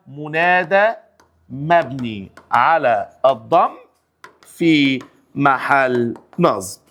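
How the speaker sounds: each word drawn out very slowly, low; slow drilled parsing. Shown as a demonstration. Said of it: "teacher drills the parsing".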